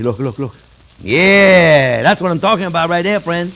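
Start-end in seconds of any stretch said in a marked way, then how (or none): 1.0-2.0 loud, drawn-out yeah; excited yell. Real, not acted. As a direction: belt it out with delight. Exclaims at the searing steak.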